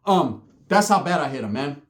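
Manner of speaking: ordinary talk, nothing marked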